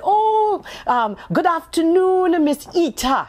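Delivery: high, slow, delighted welcome; greeting woman. Retold or imitated. Librarian warmly greeting the young author, high and slow. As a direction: beam as you greet the honoured guest. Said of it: "this wonderful woman greeted me and she said".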